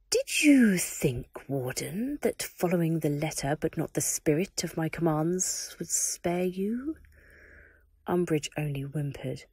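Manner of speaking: dark lord scolding a servant; menacing Voldemort. Acted. Voldemort threatens the Warden.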